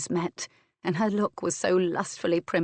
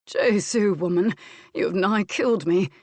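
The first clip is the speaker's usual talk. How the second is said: panting, louder after exertion; out of breath; 5 dB louder than usual. Acted. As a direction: speak through panting breaths.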